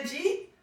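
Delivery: "Jeet", did you eat run into one syllable; Queens slurred "Jeet". Retold or imitated. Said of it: "the way we say it at home in Queens".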